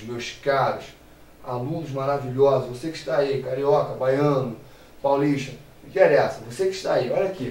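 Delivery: usual speaking voice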